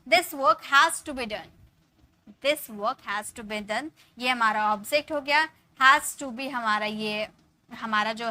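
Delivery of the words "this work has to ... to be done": very slow, words spaced out; slow dictation. Shown as a demonstration. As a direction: dictate slowly. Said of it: "यानी कि passive है". (धीरे-धीरे लिखवाना)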